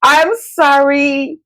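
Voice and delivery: very slow, high, sing-song sorry; mock the fake apology; drawn-out mock apology. Mocking husband's non-apology.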